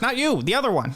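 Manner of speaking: sudden high correction; high quick correction to the wrong listener; NPC retort. Acted. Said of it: "And he goes".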